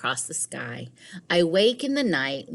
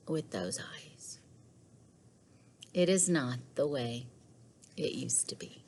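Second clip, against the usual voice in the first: hushed whisper; 4 semitones lower, 9 dB quieter than usual. A narrator's reading. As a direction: breathe it like a dark secret. Ominous narration ending chapter.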